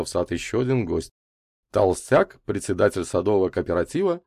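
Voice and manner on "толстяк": usual speaking voice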